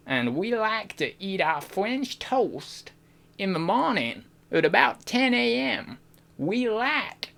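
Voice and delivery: slow, exaggerated southern drawl; southern accent. Shown as a demonstration. Streamer showing chat a southern accent, slow smooth drawl. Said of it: "I can do a good southern accent".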